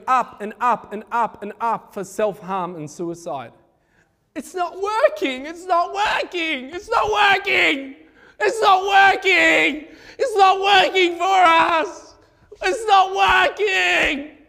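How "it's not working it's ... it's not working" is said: much higher, building from tense to a crying, laughing shout; desperate cry building. Acted. Teenagers of a generation crying out it's not working, building to a desperate shout. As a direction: let the despair rise until it breaks into a slow shout.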